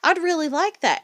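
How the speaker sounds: high, loud, gushing mock girl voice; gushing friend. Mom mimicking her daughter's greedy friend, high gushing mock voice. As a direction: ham up an eager kid who wants the shirt. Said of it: "her friend's gonna be like".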